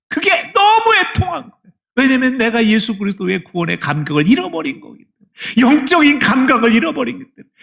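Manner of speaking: loud, high, rising sermon voice; fiery preaching. Acted. Pastor urging the congregation toward heaven, fiery preaching. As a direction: press the question on the congregation.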